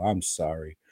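ordinary delivery

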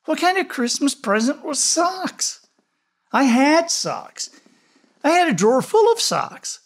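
put-on high, loud, whiny child protest; whiny complaint. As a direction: whine like a cheated kid. Child complaining about socks.

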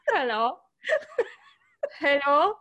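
lightly mocked German-sounding accent; questioning lift on a German-boy greeting; Robert Pattinson. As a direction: practise a bad German-tinged American accent. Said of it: "practice my impression of that".

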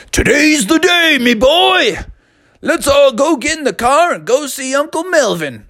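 high, jolly dad; cheery dad voice. A narrator's reading.

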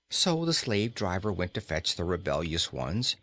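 plain reading voice

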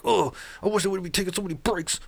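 imitating a petulant complainer; whiny complaining.

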